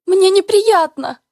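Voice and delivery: high loud slow shriek. Acted. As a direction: shriek it out in panic. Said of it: "Голос больше похож на визг".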